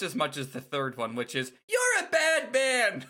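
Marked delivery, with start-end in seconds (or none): 1.7-3.1 put-on high, slow child's accusation; little boy voice. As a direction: scold like a small kid. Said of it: "It's just a little boy".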